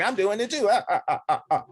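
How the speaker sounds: high mocking copycat voice. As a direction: tease in a high silly voice.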